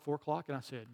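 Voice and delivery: ordinary talk, nothing marked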